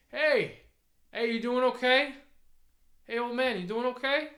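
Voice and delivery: high, loud concerned call. Acted. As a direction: shout from the truck window. Driver calls out to old man.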